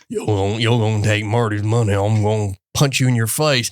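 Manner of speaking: a lightly voiced Missouri threat; mock-threaten in a put-on Missouri accent. Imitating an Ozark character's threat, in a light Missouri accent. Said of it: "Missouri accent or whatever it is".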